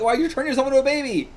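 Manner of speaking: high, quick disbelieving question; baffled exclamation. Real, not acted. Reader reacting to a baby transformation, baffled. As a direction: demand it, baffled.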